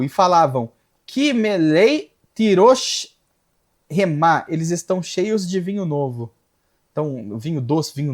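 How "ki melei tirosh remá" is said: slow, drawn-out Hebrew reading; deliberate, stretched verse reading; scripture recitation. Real, not acted.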